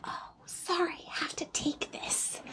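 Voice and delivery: whispered excuse, breathless and hushed. Retold or imitated. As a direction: whisper the excuse to slip away. Excusing herself to take call.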